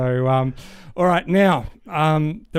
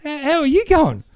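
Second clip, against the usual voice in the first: much higher, chirpy Aussie bloke; telling his mate he feels great, much higher and chirpy; 11 semitones higher, 3 dB louder than usual. Retold or imitated. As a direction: pitch Dave up, pleased with himself. Dave voiced in the dad joke.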